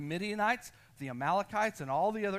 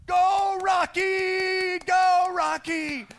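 cheering shout; 13 semitones higher, 12 dB louder, much slower than usual. Retold or imitated. A bystander cheers a jogger on with a big high shout. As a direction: shout it much higher and louder, like a fan at a race. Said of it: "this one guy goes".

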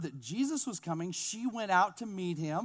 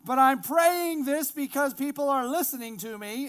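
loud, high projected praying; boisterous prayer; 7 semitones higher, 8 dB louder than usual. Acted. Jesus praying aloud before the bystanders, boisterous prayer. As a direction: pray loudly for the onlookers to hear. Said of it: "kind of a boisterous prayer".

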